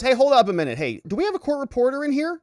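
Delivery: high, loud, fast flustered judge; mock-panicked judge asking for the reporter; judge hamming it up. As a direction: play the judge as suddenly alarmed. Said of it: "Judge says".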